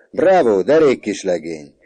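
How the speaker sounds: loud, high bravo; hearty praise. A narrator's reading. Father praising his son's schoolmate, hearty praise. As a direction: cheer the lad at full voice.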